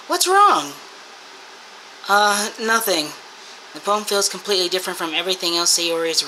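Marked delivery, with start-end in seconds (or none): 0.1-0.7 higher, lightly voiced girl; a light worried girl voice; lightly voiced concerned classmate; voiced worried friend. Friend voiced in game reading.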